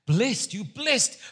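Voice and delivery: high, slow exclamation; exclaiming. Acted. Jesus replies to Peter's answer, exclaiming.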